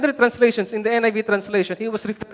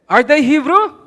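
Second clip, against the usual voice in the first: loud, raised rhetorical question; ringing challenge to the room; acted challenge; 5 semitones higher, 9 dB louder than usual. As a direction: throw the question out, rising high. Rhetorical challenge from quoted letter.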